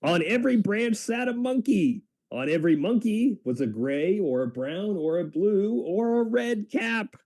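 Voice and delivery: put-on bright, rising storyteller voice for kids; reading to children. A narrator's reading. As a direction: draw the listener into the suspense. Reads children's book aloud.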